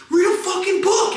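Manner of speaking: high, strained shout from a passerby; imitating an angry stranger yelling; heckler's yell. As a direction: holler it high at someone down the street. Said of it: "I hear somebody yell".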